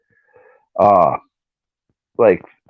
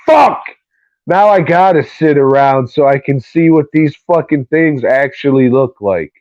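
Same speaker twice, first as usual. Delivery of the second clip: frustrated yell; 5 semitones higher, 3 dB louder than usual. Retold or imitated. Retold outburst seeing puppet pictures.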